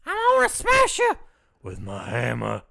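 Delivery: very high, loud, laughing giantess; orc woman voice. Acted. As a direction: ham up a seven-foot female half orc, shrieking. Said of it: "and I was like".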